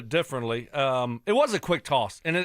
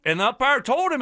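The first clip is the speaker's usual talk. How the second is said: usual speaking voice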